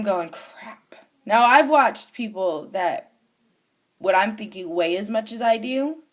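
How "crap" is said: whispered curse under the breath; whispered dread. Retold or imitated. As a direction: whisper it to yourself, dreading your turn. Retelling her dread while watching.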